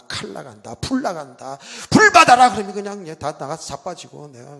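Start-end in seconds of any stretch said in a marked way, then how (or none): 1.9-2.5 high, loud cry of a faith healer; mock revival shout. Preacher mimics a revival leader, high loud shout.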